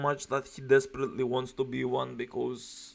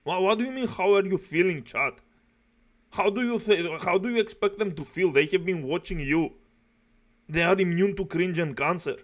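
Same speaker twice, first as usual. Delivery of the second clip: higher, insistent angry rant; real exasperation; 3 semitones higher, 3 dB louder than usual. Real, not acted. Viewer scolds the streamer for polling chat, exasperated. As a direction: tear into him, exasperated.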